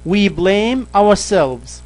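slow, careful reading of the English example. Shown as a demonstration. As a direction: read each word slowly for the learner. (قراءة بطيئة وواضحة للمثال)

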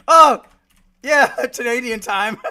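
very loud, high-pitched cry into laughter; laughing outburst. Real, not acted. Streamer joking to chat about Canadian time, laughing outburst. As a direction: burst out, then joke through laughs. Laughing at own joke on clocks.

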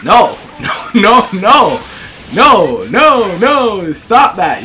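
short loud bursts of protest; panicked outcries. Real, not acted. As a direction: yell at the game in alarm. Calling out at the game.

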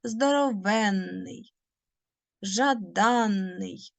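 Здоровенний and жаданний drawn out slowly, stress on the suffix with a long double н; slow dictation, leaning on the нн; slow stressed demo. Shown as a demonstration. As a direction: spell it out by sound, nice and slow: Здоровенний, жаданний. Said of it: "наголос падає".

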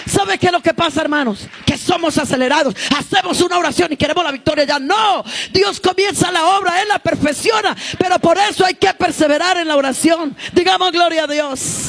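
loud, high fiery preaching. Acted. Preaching at full force. (predicación encendida)

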